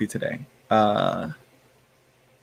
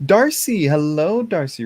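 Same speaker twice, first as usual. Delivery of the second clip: excited gasp greeting; 5 semitones higher, 3 dB louder than usual. Real, not acted. Greeting a viewer by name.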